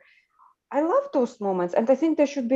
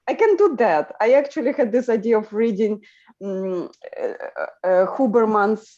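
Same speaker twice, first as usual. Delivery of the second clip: ordinary talk, nothing marked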